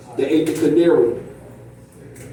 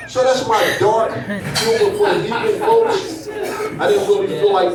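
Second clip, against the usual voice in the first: chuckling after the punchline; laughing; 5 semitones higher than usual. Real, not acted. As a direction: talk through a chuckle. Still laughing after joke.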